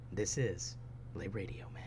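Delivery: breathy whispered sign-off. Acted. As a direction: whisper the station name. Whispered sign-off of radio show.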